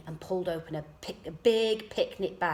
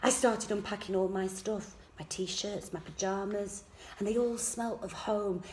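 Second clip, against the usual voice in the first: plain reading voice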